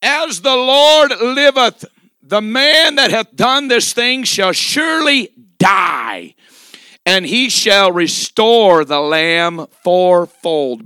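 slow, booming proclamation of judgment; thundering verdict. Retold or imitated. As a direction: pronounce the death sentence like a furious king. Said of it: "He said".

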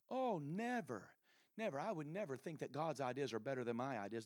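very soft, demure protest; quietly feigned innocence; mock denial. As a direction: play the innocent church member, hushed. Voices congregant's mock denial.

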